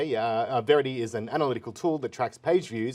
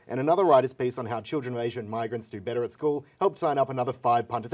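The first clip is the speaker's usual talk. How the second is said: usual speaking voice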